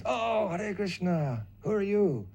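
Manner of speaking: high, slow, blissed-out greeting; blissful devotee. Retold or imitated. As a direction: beam at the newcomer, drawn out and high. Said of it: "big blissful face".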